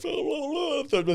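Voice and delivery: high-pitched mocking gibberish gripe; mock griping. Roofer imitating a crabby homeowner, mock griping. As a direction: imitate a crank grumbling in a high voice.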